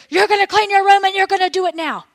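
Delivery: put-on dad yelling at the kid to clean the room; raging dad. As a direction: bark it like an enraged stepdad. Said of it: "dad is in a rage".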